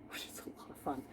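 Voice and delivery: quick, soft unvoiced whisper; whispered aside. Real, not acted. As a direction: breathe it out as a secret aside. Aside about drama uni days.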